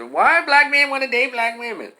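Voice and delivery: high, mocking put-on voice of a podcast host; mocking quote. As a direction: send up the question in a high voice. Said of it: "get on the news and on podcasts and say".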